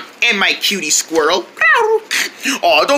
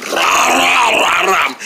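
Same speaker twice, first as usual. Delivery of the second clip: ram calls; much slower than usual. Acted. Ram character bleating.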